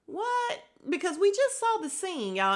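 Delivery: high, startled squeak of disbelief; shocked what. Retold or imitated. Recapping the show to viewers, a high shocked what. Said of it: "And I'm like".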